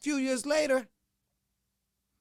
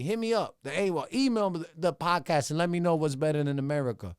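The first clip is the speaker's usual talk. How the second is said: ordinary delivery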